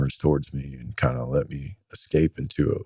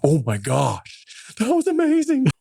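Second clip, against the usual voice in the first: much higher, slow then squeaky gush of amazement; amazed aide; slower than usual. Retold or imitated. Political aide thrilled by his handling, squeaky gushing amazement.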